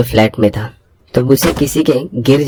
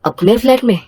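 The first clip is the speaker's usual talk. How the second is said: high, unsure echo of her words; hesitant surprised question; 11 semitones higher than usual. Acted. Man hesitantly asks neighbour back, surprised and high. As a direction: hesitantly ask it back, startled. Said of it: "थोड़े झिझकते हुए पूछा".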